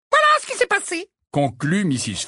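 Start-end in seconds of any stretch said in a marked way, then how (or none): 0.1-1.1 put-on high old lady wrapping up awkwardly; awkward old woman. A narrator's reading. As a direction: trail off clumsily, high-pitched. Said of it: "un peu maladroitement".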